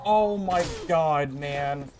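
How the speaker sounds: slow, drawn-out groan of oh my god; exasperated. Real, not acted. Gamer reacting to losing, slow exasperated groan. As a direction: drag it out in disbelief. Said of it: "I'm so mad".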